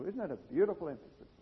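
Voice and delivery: ordinary talk, nothing marked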